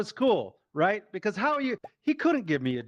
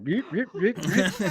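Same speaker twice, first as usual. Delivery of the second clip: imitating a reversing truck beep; 4 semitones higher, 3 dB louder than usual. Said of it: "I'm like".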